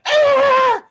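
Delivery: sudden high shriek of pain; a sharp pained creature screech; creature cry. Acted. Monster cries out when speared. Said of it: "you hear something like".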